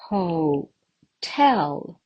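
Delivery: Ho-tel, climbing to a strong TEL; stress on second syllable. Shown as a demonstration. Teacher showing stress like climbing stairs, second syllable stressed. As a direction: lean on the "tel" of Ho-tel. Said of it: "The stress mark falls on the second syllable".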